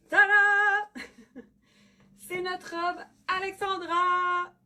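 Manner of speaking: high, slow showy announcement; excited reveal. Real, not acted. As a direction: unveil it with a flourish. Revealing the dress on camera.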